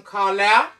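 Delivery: ordinary talk, nothing marked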